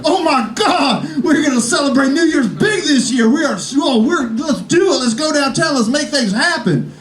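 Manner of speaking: high mock-thrilled family chatter; imitating the excited family. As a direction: gush like family thrilled by a limo. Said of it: "They're like".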